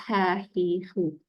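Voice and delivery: "Ha, hi, hu" with air released before the voice; soft Arabic throat h on "Ha, hi, hu"; soft airy ha. Shown as a demonstration. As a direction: let the breath out first on "Ha, hi, hu". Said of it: "Angin keluar dulu".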